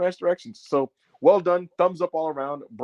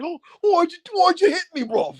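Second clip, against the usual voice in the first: very high, louder hurt whine; betrayed disbelief; 3 dB louder than usual. Retold or imitated. Ospreay imitated begging his betrayer, very high and hurt. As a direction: plead like a stunned friend. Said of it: "He's making that face like".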